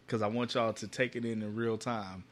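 ordinary talk

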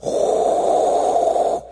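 huge blown whoosh of wind; tornado roar. Retold or imitated. Preacher imitating the tornado coming into his room, loud rushing roar. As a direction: imitate a roaring tornado with a long blast of air. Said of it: "You know how a tornado sounds".

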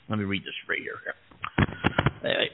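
ordinary delivery

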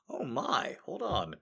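usual speaking voice

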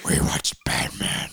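raspy whispered growl; hoarse, voiceless superhero rasp; Batman. Shown as a demonstration. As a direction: show how to growl it like the hero. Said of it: "No, like this".

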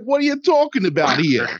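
loud, high Brooklyn outburst; Brooklyn accent. Retold or imitated. Podcast host mocking an actress's accent slip, Brooklyn accent. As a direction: belt it out in a broad Brooklyn accent. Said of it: "slips into a Brooklyn accent".

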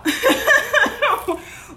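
sudden high giggle at herself; high embarrassed laugh. Real, not acted. Admitting an embarrassing slip to listeners, high sheepish laugh. Laughing at her own story.

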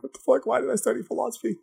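high, soft, rushed mock despair; despairing philosophy student. A student crying in the corner over philosophy, high mock despair. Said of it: "one crying in the corner".

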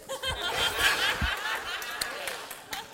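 long, high-pitched laugh. Real, not acted. Preacher laughs about arguments with his wife, long high laugh. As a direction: crack up in a high drawn-out laugh.

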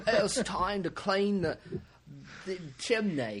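slow, hammed-up Cockney; Cockney accent. Co-host joking as a street chimney sweep, Cockney accent. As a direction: play an old-time Cockney chimney sweep. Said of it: "It sounded like Cockney, like eighteen hundreds".

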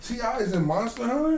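can't believe what you just heard; surprised disbelief. Real, not acted. Friend reacts to T.I. being cast, genuinely surprised. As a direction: pitch jumps up in a surprised question.

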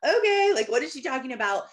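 high, loud mock-baffled okay, easing down; sceptical listener. Doubter hearing her talk of God, mock sceptical. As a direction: play the doubter reacting to woo-woo talk. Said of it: "you probably think".

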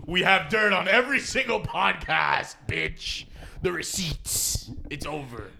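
cocky boast, slow and loud; gloating like holding receipts; smug threat. Pretend. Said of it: "and we're like".

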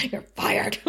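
a hint of a demon voice; lightly voiced Anya demon. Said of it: "in her demon voice".

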